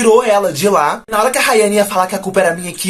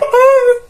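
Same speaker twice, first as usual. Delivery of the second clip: short high laugh; 6 dB louder than usual. Real, not acted. Laughs before his answer.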